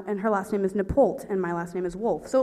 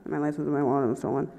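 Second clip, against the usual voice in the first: fast, low mumble; rushed repeat; 6 semitones lower, much faster than usual. Retold or imitated. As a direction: rattle the confession off under your breath. Repeats after cop says What.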